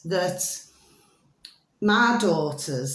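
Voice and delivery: ordinary delivery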